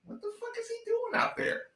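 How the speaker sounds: high, disbelieving mock commentator; incredulous national commentator. Podcaster imitates national commentators' disbelief, high-pitched. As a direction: throw up your hands in disbelief. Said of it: "looking at this saying".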